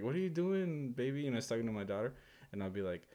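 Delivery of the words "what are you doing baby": put-on soft, higher-pitched baby talk; super calm. Wife talking sweetly to her daughter, super calm. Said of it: "super calm, by the way".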